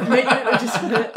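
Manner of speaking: fast, laughing through the words. Real, not acted. Player finishing Alex's joke to the table, laughing. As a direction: giggle the punchline out quickly.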